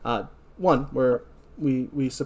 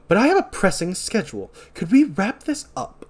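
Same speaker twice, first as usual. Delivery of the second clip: high, louder acted impatience; impatient; 8 semitones higher, 4 dB louder than usual. Doctor Mixer interrupts a heartwarming moment, impatient and high. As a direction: pointedly hurry them along.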